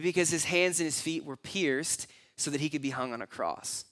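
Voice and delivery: ordinary talk, nothing marked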